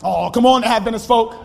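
ordinary talk, nothing marked

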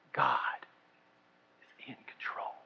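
God is in control, whispered without voice. Retold or imitated. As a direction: breathe the words gently. Grandmother comforts the jobless listener.

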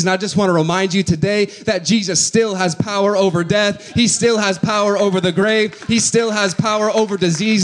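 loud, high, building sermon climax; preaching. Acted. Pastor assuring the congregation Jesus knows pain, preaching loud. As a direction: lift the room with hope.

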